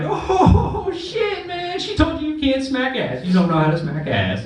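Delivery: high, laughing taunt; giddy mocking of a friend. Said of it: "I'm like".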